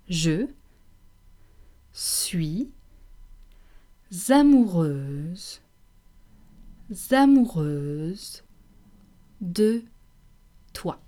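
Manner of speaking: je suis amoureuse de toi, amoureuse drawn out slowly; slow syllable drill. Shown as a demonstration. Teacher drilling the feminine form for the class. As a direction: stretch amoureuse and repeat it. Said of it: "this is what you should say".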